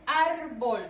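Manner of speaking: "árbol" with a loud, drawn-out ÁR; "árbol" in two syllables, first one stretched; stressed first syllable. Shown as a demonstration. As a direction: lean hard on the ÁR of "árbol". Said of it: "recae ahí la mayor fuerza de voz".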